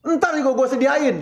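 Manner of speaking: high, put-on snapping wife; hammed-up busy wife scolding back; a wife snapping at her husband after guests leave; mock angry wife. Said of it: "jadinya ribut".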